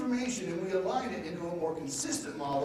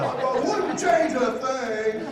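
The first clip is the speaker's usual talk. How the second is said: slow, higher, louder mock earnest answer; mock sincere; 8 semitones higher, 8 dB louder, much slower than usual. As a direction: drag out a fake humble answer. Mocking the kiss-ass answer.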